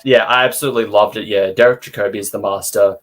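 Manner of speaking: usual speaking voice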